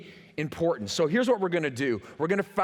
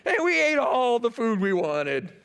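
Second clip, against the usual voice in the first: higher put-on whiny voice; mocking nostalgic Israelites; 6 semitones higher, 4 dB louder than usual. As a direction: lampoon them as they long for the food. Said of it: "Listen to the way they describe slavery".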